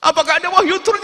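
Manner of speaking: high, loud panicked cries; terrified boy crying out to Umar; frightened boy. Acted. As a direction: plead in terror like a startled child. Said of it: "Ketakutan dia".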